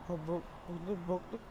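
normal talking voice

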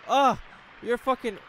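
loud high yelps; panicked yelling. Real, not acted. Gamer fighting off a monster, yelling. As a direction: shout in panic mid-fight.

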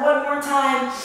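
ordinary talk

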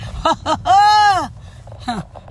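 long, high cackle; mocking laugh. Real, not acted. Wife scoffing at her husband's claim, mocking laugh.

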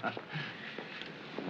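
quiet, breath-light laugh; soft chuckle. Acted. Man asked about clothes and things, chuckling softly.

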